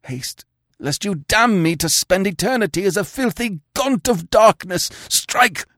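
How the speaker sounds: high, loud commanding voice building to a cry; imperious command. Acted. Dying king commands his sister to strike, building imperious. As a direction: order her with a king's authority. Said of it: "the old imperious note in his failing whisper".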